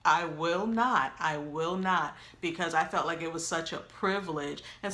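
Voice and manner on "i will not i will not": slow, emphatic refusal; retold stubborn refusal, drawn out; serious attitude. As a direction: dig in, word by word. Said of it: "I really had a serious attitude".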